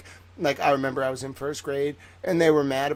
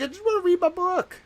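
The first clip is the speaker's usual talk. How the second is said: very high mock-child plea; imitating a small child's protest; whiny six-year-old; 4 dB louder than usual. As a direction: squeal it like a thwarted little kid. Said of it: "I was, like, six".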